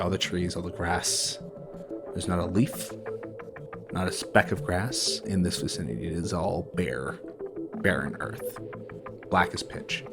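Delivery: usual speaking voice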